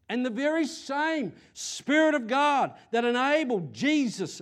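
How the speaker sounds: loud, high fervent preaching; full-force sermon delivery. Acted. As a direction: preach it out with fire.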